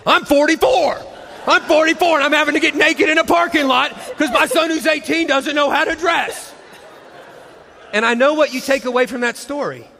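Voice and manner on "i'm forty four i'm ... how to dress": hammed-up meltdown, shouting it out; frantic shout. Mom stripping in the parking lot, shouting in exasperation. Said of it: "I start pulling my clothes off saying".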